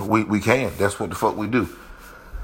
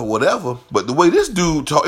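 usual speaking voice

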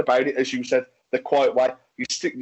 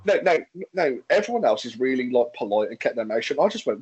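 ordinary talk

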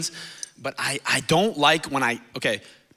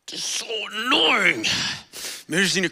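crying; 7 semitones higher than usual. Real, not acted. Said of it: "now I'm crying".

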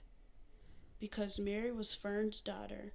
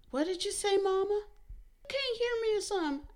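much higher, louder, disbelieving; shocked shout; 11 semitones higher, 9 dB louder, faster than usual. A narrator's reading. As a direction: yell it in outrage. Mary shocked, yelling.

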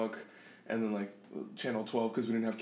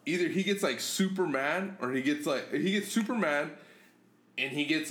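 ordinary talk, nothing marked

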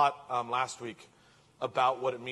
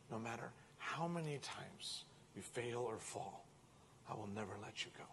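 tender reassurance; 12 dB quieter than usual. Acted. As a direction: hush it, steady and gentle. God answering the worried listener.

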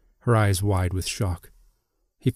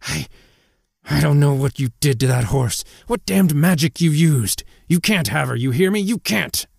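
raised, high-pitched angry outburst; so angry the words barely come out; enraged, climbing protest; furious sputter; 6 semitones higher than usual. A narrator's reading. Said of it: "He could barely get the words out, he was so angry".

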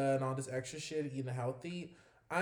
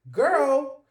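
loud exclamation; 9 semitones higher, 12 dB louder than usual. Real, not acted. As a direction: shout it out in disbelief.

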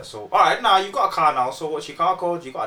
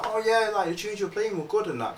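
lightly voiced woman, pitched higher; imitated friendly woman; 8 semitones higher, 3 dB quieter than usual. Retelling a singer praising the warm-up set, lightly imitated. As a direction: lift the voice to imitate her compliment. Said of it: "And then she's like".

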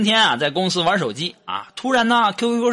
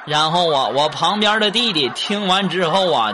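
ordinary talk

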